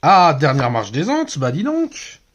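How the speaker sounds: loud, delighted surprise at the pull; excited exclamation. Real, not acted. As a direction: exclaim it, thrilled by the card. Excited pull of a good card. (s'exclamer, ravi et fort)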